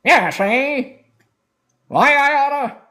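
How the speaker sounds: put-on high, loud old-timey tough guy, slow on the first line; a mock old-film hoodlum squawking back; 1930s gangster. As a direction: ham up a thirties movie gangster. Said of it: "That's more the thirties".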